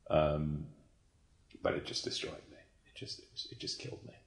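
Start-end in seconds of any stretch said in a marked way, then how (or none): 1.6-4.1 very soft, voice nearly gone; quietly overcome. Real, not acted. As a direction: let it fade almost to a whisper. Moved again recalling the show.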